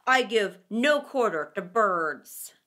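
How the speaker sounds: low, loud refusing weasel; stern weasel. A narrator's reading. Weasel refusing the bat's plea, low and loud. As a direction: flatly deny the bat.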